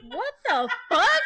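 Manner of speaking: high, drawn-out baffled outburst; retold disbelief. Her stunned reaction, retold.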